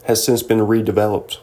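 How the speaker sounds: ordinary delivery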